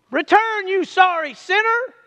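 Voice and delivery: high scornful Return, you sorry sinner; mock scolding; mock harsh call. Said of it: "he didn't say".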